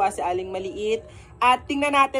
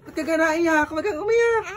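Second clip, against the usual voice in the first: high, louder cooing; mock baby-talk comfort; 8 semitones higher, 4 dB louder than usual. Vlogger cooing to the toy not to cry. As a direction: coo over a crying little one.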